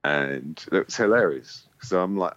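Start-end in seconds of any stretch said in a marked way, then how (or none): none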